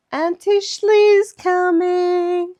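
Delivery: high, loud, drawn-out squeal of anticipation; slow, high-pitched gleeful holler; excited child. Retold or imitated. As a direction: imitate a thrilled little niece, stretching the words. Acts out niece's excitement.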